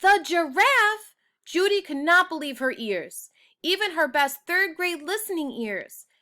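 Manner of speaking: Judy can't believe she won the award; amazed disbelief. Acted. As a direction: squeal it like a kid who just won.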